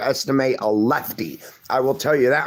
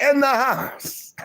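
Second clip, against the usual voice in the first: "In the house" pitched much higher and drawn out slowly; hype man call; 11 semitones higher, slower than usual. Retold or imitated. Echoing a viewer's chat greeting, high drawn-out hype call. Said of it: "Shepherd One is like".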